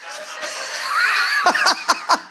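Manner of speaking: a squealing, airy burst of laughter; high breathless laugh. Real, not acted. Laughing while telling about his wife lifting him off the ground.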